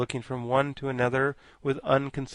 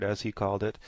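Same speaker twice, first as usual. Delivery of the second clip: ordinary delivery, nothing marked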